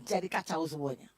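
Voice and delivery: normal talking voice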